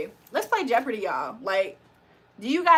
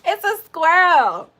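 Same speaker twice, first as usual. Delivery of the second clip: high, loud, drawn-out squirrel; mock exclamation; 6 semitones higher, 8 dB louder, slower than usual. Playing with the funny word, mock exclamation. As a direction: belt it out slowly and high.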